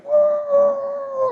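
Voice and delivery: long, very high whoop; loud mock squeal while swerving; stretched-out falsetto cry. Retold swerving the car.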